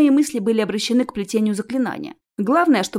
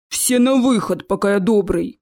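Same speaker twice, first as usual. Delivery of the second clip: troll voice; 4 dB louder than usual. Acted. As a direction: order them out as a lumbering troll. Troll orders everyone out.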